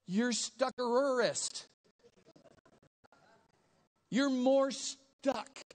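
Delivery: usual speaking voice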